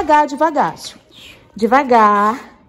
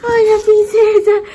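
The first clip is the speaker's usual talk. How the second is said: high, slow cooing to a beloved dog; sweet pet talk; 12 semitones higher, 5 dB louder, slower than usual. Real, not acted. Mother back home greeting her dog, high slow coo.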